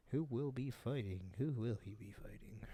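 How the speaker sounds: whispered muttering to himself; thinking aloud. Real, not acted. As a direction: whisper it absently, searching your memory. Talking to himself while recalling.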